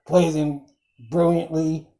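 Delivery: usual speaking voice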